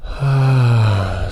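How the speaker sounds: slow, releasing sigh; long audible exhale. Shown as a demonstration. Guide models letting go while breathing, long sigh.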